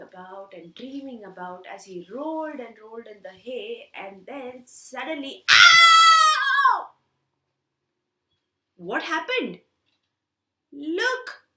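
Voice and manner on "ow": sudden high loud ow; shrill burst of pain; pained yelp. Acted. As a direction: yelp like a pricked pig. Pig pricked by thistle, cries out.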